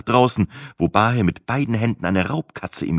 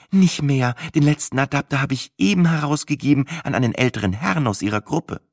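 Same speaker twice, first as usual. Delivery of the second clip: lightly voiced, higher female host; higher, measured, obliging voice; farmer's wife; 8 semitones higher than usual. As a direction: play the helpful farm hostess, pitched up.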